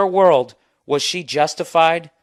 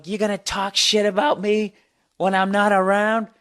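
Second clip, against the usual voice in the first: higher voiced confronting woman; imitating the angry woman; 7 semitones higher than usual. Woman confronts him about his joke, imitated accusing voice. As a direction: accuse him like she did, mock-confrontational. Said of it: "And then she goes".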